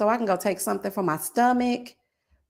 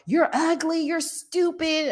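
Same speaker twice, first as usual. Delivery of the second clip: mock insult; 7 semitones higher, slower than usual. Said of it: "I really just don't be getting into it like".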